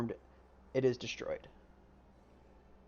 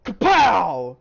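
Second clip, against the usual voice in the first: loud; 6 semitones higher, 13 dB louder than usual. Acted. DM narrating big hit.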